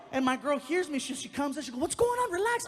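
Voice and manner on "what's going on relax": high, loud mock female voice; alarmed girlfriend. Girlfriend rushing in to calm him down, high and alarmed. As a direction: shoot the pitch up as the startled girlfriend. Girlfriend rushes in at his yelling.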